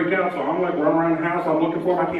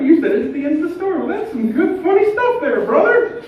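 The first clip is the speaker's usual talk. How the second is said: mock buddy; 9 semitones higher than usual. Mocking the audience heckler.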